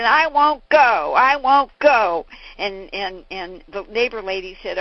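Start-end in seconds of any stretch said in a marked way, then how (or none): 0.0-2.3 loud, slow, dug-in refusal; a sulky boy shouting he won't budge; stubborn refusal. Retold or imitated. Sickly Jack refusing the neighbor lady, loud and stubborn. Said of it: "Jack said".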